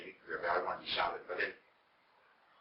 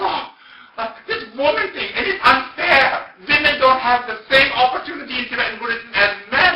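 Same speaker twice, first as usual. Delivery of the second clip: high, loud indignant tirade; impassioned mock rant, pitched way up; passionate protest; 18 dB louder than usual. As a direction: imitate a woman railing at unfairness. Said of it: "she was very passionate".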